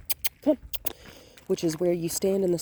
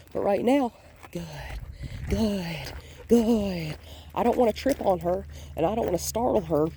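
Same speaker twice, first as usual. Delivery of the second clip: ordinary talk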